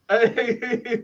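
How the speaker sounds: ordinary talk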